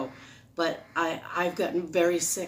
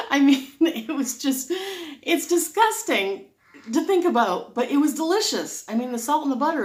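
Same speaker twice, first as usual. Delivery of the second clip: excited; 8 semitones higher, 5 dB louder than usual. Real, not acted. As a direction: pitch up, relish then cringe. Recalls buttered corn, disgusted delight.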